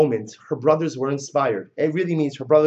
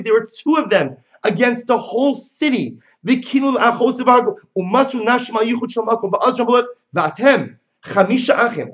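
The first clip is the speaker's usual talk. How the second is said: high raised urgent exhortation; rebuking bride; 8 semitones higher, 5 dB louder than usual. Acted. Bride urging her brothers-in-law, raised urgent voice. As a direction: urge the brothers on fiercely.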